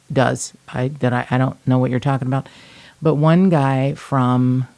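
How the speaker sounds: usual speaking voice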